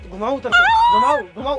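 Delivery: a high mocking squeal, then a laugh; mock her demand, laughing. Husband mocks his wife's complaint about outings, laughing.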